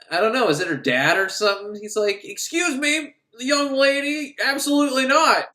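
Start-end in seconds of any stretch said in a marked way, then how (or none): 2.2-5.5 high-pitched mock parental reprimand; scandalized dad. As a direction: scold like an affronted father. Acted dad scolding daughter.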